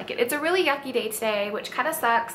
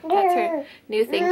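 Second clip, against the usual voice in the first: usual speaking voice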